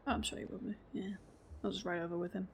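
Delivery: normal talking voice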